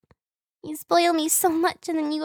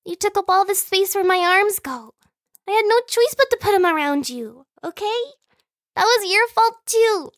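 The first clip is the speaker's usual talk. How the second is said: high, loud, indignant self-defense; playful protest; 8 semitones higher, 5 dB louder than usual. Acted. Sibling blames the other for taking space, playful protest. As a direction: push the blame back in a raised pitch.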